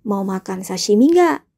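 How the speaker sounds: normal talking voice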